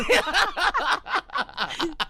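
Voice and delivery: high burst of laughter. Real, not acted. Host cracks up at joke.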